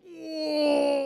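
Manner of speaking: long high, loud wail; mock pained "ooh". Streamer showing how he falls down stabbed, mock wail. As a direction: ham up a wounded howl.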